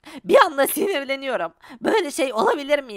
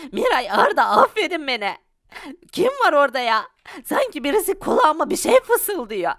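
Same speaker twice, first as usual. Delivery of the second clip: ordinary talk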